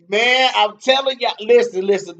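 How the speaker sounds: fired-up loud insisting; excited loud urging. Real, not acted. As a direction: raise your voice to hype the fight. Urging viewers about the fight.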